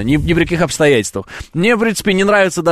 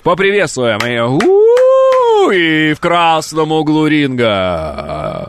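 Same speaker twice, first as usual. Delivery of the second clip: boxing ring announcer; much slower than usual. Pretend. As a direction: hype the crowd like a fight MC. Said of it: "ты как этот, как будто в М М А". (анонсер ринга, протяжно)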